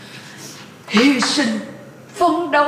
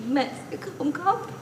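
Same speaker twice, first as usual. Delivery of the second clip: softer, tearful promise; holding back tears; 9 dB quieter than usual. Acted. Mother promises her son she won't cry, fighting tears. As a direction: choke back crying as you promise. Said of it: "Mẹ đừng có khóc nữa".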